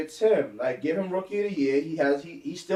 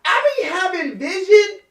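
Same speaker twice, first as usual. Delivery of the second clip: laughing; 12 semitones higher, 5 dB louder than usual. Real, not acted. Bragging while laughing.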